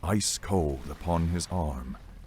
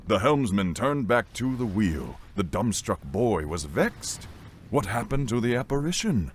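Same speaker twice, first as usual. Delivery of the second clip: ordinary delivery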